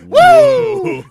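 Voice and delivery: very high, loud Flair woo; Ric Flair woo. Retold or imitated. Imitating Ric Flair's trademark call, huge shouted woo. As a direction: let rip a wild woo. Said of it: "I knew woo was the thing".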